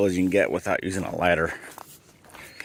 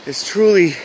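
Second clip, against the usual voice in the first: exasperated; 6 semitones higher than usual. Real, not acted. Complaining about the brutal climb.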